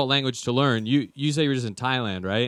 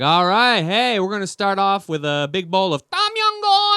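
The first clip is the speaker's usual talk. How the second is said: louder and higher, showing off; loud showy ordering; 7 semitones higher, 6 dB louder than usual. Retold or imitated. Kicking open the door at the Thai place, loud showy order. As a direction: bellow the order like you own the place. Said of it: "I was like".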